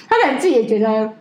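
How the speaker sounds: ordinary talk, nothing marked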